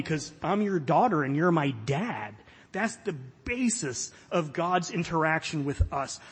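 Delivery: ordinary talk, nothing marked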